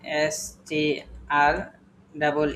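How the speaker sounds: STR drawn out very slowly letter by letter; slow spelling. Shown as a demonstration. Teacher spelling out STR very slowly for the students. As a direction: stretch out each letter of STR. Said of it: "spelling letters slowly for class".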